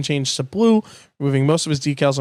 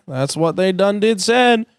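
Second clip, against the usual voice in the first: Mater voice; 6 semitones higher than usual. Acted. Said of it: "Tow Mater".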